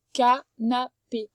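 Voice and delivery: canapé drawn out slowly, each syllable clear; slow sounding out. Shown as a demonstration. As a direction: stretch canapé for a child to hear. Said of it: "et canapé". (canapé, lentement, en détachant les syllabes)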